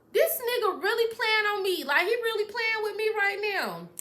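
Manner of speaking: high-pitched retold indignation; indignant outburst. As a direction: protest high, like you've been played. Retold anger at being played.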